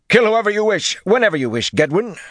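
loud, very high-pitched flourish; high bravado call. A narrator's reading. Wyraman drawing sword to charge, high bold call. Lord laughs, draws sword, addresses companion.

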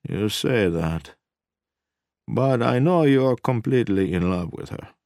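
thoughtful. A narrator's reading. Kaminsky replying to the husband about his wife, thoughtful. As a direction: ponder it, drawing the words out. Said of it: "Kaminsky said thoughtfully".

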